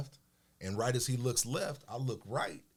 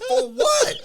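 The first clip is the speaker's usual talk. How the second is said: incredulous; 14 dB louder than usual. Retold or imitated. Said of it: "I'm like".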